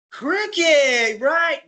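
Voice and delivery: high, bright mock quiz guess "Cricket, right?". Shown as a demonstration. Quizzing the audience before revealing hurling, a high teasing guess. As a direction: bait the audience with a wrong answer.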